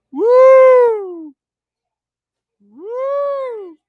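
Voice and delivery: two long, very high woos, first one loud; cheering whoops. Real, not acted. Film fan cheering on the scene, whooping.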